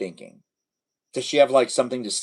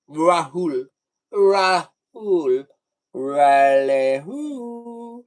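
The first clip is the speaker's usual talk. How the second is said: playful name calling; 3 semitones higher, 4 dB louder, much slower than usual. Pretend.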